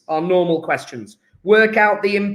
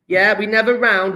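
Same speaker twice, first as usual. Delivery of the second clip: ordinary talk, nothing marked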